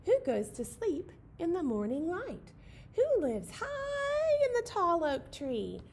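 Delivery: high, slow storybook owl voice; high hooting read-aloud for kids; sing-song owl rhyme. Acted. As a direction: lilt it like a nursery rhyme owl.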